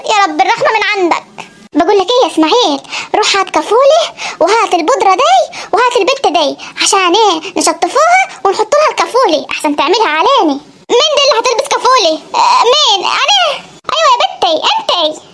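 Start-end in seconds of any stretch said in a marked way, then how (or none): 1.7-10.5 put-on lower, commanding old woman; a granny giving orders; bossy grandmother. Grandmother ordering grandfather to fetch a diaper, low and bossy. 13.9-15.2 put-on a wary old villager; old Saidi grandfather. Grandfather answer.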